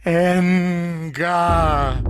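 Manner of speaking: loud, high mournful call; anguished cry. Acted. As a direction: cry the lost city's name. The city cried out.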